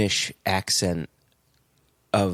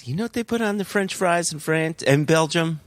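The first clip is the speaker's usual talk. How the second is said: higher, quicker movie-quote voice; recalled movie dialogue, pitched up; movie character quote; 8 semitones higher than usual. Acted. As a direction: put on a film hitman's chatty line, higher and brisk. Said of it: "what did he, what did he say?".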